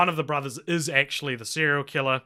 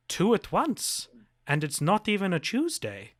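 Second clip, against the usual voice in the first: higher-pitched mock villain line; lightly voiced film character, pitched up; imitating the auditor; 6 semitones higher than usual. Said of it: "it cuts to the auditor and he's like".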